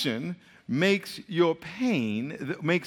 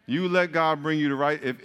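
usual speaking voice

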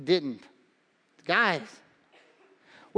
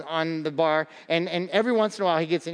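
ordinary talk, nothing marked

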